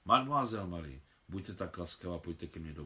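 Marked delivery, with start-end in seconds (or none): none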